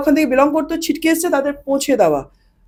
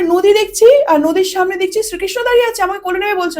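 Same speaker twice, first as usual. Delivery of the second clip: put-on 1 girl's voice; 6 semitones higher than usual. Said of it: "বলে".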